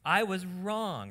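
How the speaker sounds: emphatic teaching delivery; deliberate model admission. Shown as a demonstration. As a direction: lay out each word slowly. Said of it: "Start saying these things".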